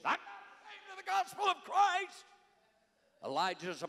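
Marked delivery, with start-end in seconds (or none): 0.0-2.3 high, loud explosive proclamation; much higher and louder fiery cry. Retold or imitated. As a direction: blast it out like Paul on fire. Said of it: "Paul's explosive".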